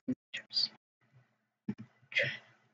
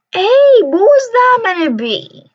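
high, loud startled protest; jolted into a high, loud protest; outraged yelp; 11 semitones higher, 5 dB louder than usual. Real, not acted. Gamer yelps high and loud at a surprise attack. Sudden outrage at game event.

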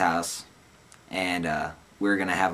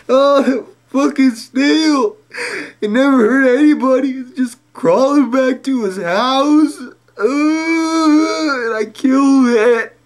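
very high, loud mock wailing; crying my eyes out; 10 dB louder, slower than usual. Stoned narrator crying his eyes out over the snail. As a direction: wail it out in fake grief. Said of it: "I'm crying my eyes out".

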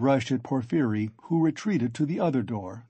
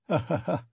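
light "ha, ha, ha" chuckle; acted teasing laugh; 3 semitones higher than usual. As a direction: laugh slyly in character.

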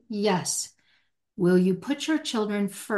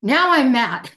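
louder, higher; louder, emphatic own-up to anger; mock declaration of anger; 5 semitones higher, 6 dB louder than usual. Shown as a demonstration. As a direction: announce the anger openly. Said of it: "And then say".

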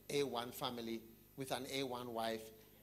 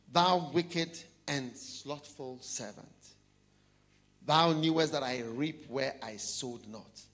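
scripture reading; 3 semitones lower, 6 dB louder, slower than usual. Acted. Repeats verse, slower, lower.

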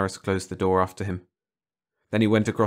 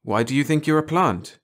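higher, louder insistent question; doctor asking again; 7 semitones higher, 4 dB louder than usual. A narrator's reading. Doctor repeats his question to the corporal, higher and louder. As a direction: push the question harder. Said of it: "Doctor Harris said again".

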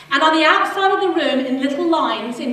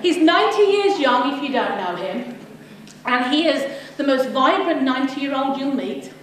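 normal talking voice

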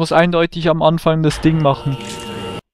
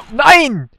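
frustrated cry; 9 semitones higher, 4 dB louder than usual. Real, not acted. Shot misses in game.

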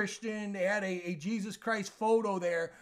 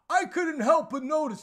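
a raised, lightly mocking Larry David voice; Larry David impression; 7 semitones higher, 5 dB louder than usual. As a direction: mimic his talk show delivery, slightly raised. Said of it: "this is how he talks".

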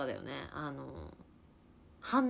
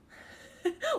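rising laugh. Real, not acted. Laughing at being told angry.

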